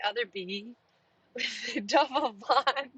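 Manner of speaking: breaking into laughter mid-sentence; laughing. Real, not acted. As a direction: laugh through the words. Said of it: "I keep laughing".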